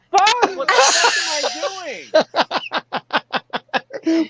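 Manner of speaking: high, slow, laughing what the fuck; laughing mock panic. Mocking his own old-man breakfast routine, high and laughing. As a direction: wail it at yourself through laughter. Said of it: "and I'm like".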